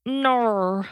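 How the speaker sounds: drawn-out Australian vowel; Australian accent. Shown as a demonstration. Guest trying the accent on request, exaggerated Australian accent. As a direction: stretch it out in an attempted Australian accent. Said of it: "Can you try an Australian accent?".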